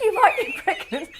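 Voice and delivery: retold line dissolving into laughter; laughing through the quote. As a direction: giggle out the sister's words. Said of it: "she went".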